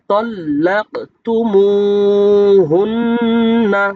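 slow melodic tajweed chant; Quranic recitation. Shown as a demonstration. Teacher recites the verse slowly for students to copy. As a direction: intone each word long and steady.

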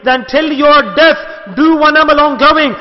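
fervent preaching of the Prophet's charge; impassioned command. Retold or imitated. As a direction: press the instruction home with force. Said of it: "That do one thing definitely".

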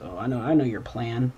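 normal talking voice

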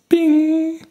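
high sung ding of a jingle chime; sung jingle bing. Pretend. Host signals a fun fact segment with a sung high bing. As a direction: chime it out like a quiz show bell. Mock jingle sound effect.

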